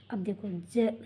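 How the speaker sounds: ordinary delivery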